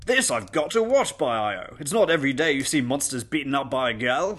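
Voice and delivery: loud, high-starting exclamation; eager male guest. Acted. Man behind governess wants to watch, loud and eager. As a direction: announce it as an amused party guest.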